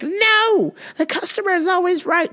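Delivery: high whiny mock voice; high stubborn mocking impression of Demi. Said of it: "She's like".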